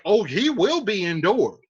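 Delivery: loud, high, indignant scolding; the uncle telling off the boy; angry paraphrase of Capulet. Retold or imitated. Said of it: "So he's like".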